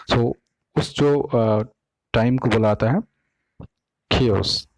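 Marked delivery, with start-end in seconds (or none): 4.1-4.7 chaos with a clear hard K at the start; chaos pronunciation demo. Shown as a demonstration. Teacher showing students the word, hard-K chaos. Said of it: "इसको मैं chaos पढ़ता हूँ".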